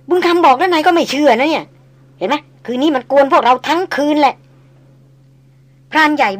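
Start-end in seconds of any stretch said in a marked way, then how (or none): 0.1-4.3 higher and louder, pressing the point; told-you-so old tracker; insistent old hunter. Acted. Old tracker insisting to his boss he was right, higher and louder.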